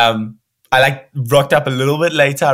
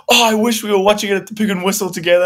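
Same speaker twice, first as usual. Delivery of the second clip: light, quick, higher voice for the friend; a lightly voiced, wistful young mate; 9 semitones higher, faster than usual. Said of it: "he was like".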